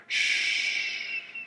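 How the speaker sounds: a soft, drawn-out airy hush; ghostly whispered shh; wind's whisper. Acted. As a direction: hush like a breeze through trees. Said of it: "whispered the wind".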